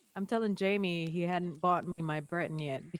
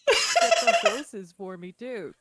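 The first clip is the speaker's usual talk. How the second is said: laughing; 5 semitones higher, 5 dB louder, slower than usual. Real, not acted. Laughing while bragging.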